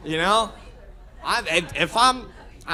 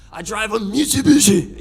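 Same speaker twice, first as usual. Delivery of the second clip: a hint of a mock Japanese accent on Mitsubishi, louder; 6 dB louder than usual. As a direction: gringo hamming a Japanese Mitsubishi. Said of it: "that's the only accent we do that with".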